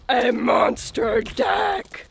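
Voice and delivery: loud, slow, hammed-up growl; mock monster voice. Mom teasing Daddy's big deck plan, monster voice. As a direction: boom it out like a monster.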